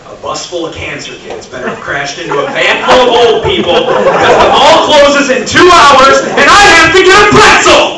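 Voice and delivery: loud high exasperated shouting; frustrated outburst. Retold or imitated. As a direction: explode after hours stuck in traffic. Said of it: "You're sitting there".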